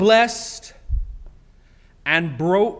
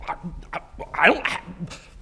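normal talking voice

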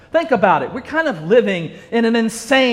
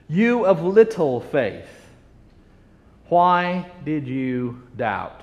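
gentle rebuke; 5 semitones lower, 4 dB quieter, slower than usual. Acted. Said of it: "saying to him".

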